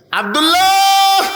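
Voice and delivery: name sung out high and drawn long; admiring name call. Acted. Preacher lingers on the son's name, drawn out high. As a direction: savour the name like a sweet word.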